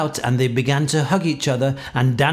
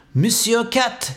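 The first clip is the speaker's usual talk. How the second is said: high, slow mayor's address; formal Lord Mayor; 8 semitones higher, slower than usual. A narrator's reading. Lord Mayor stopping in front of Toby, formal high address. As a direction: call out slowly in a raised voice.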